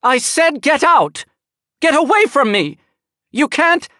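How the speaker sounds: high, loud shout, slowed on the first words. Acted. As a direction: scream at the unseen intruders to keep away. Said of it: "continued to plead".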